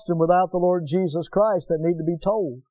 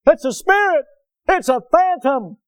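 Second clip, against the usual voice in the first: loud, high mock cry of fear; frightened disciples; 11 semitones higher, 7 dB louder than usual. Preacher voicing scared disciples seeing Jesus, high fearful cry. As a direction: shout it like terrified men at sea.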